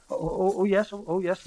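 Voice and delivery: high, stammering editor; flustered, halting agreement; gulping, flustered. A narrator's reading. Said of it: "gulped the editor".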